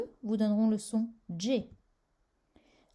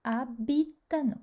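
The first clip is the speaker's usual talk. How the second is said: abitano stressed on its opening A; stress on fourth-last syllable. Shown as a demonstration. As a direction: hit the first A of abitano. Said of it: "sur la quatrième syllabe avant la fin". (accent tonique sur le premier « a » de abitano)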